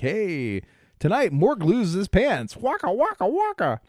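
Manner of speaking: hammed-up promo voice climbing high; mock TV announcer. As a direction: sell a silly teaser, ending on high comic wakas. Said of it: "the thing that the announcer would say".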